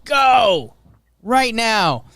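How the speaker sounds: loud high hype shout; excited shout. Acted. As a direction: yell it like a hype man. Ad read urging listeners.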